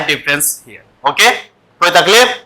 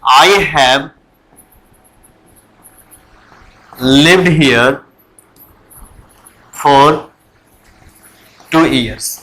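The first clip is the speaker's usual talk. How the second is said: slow dictation; 5 semitones lower, much slower than usual. Shown as a demonstration. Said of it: "और दूसरा लिखे".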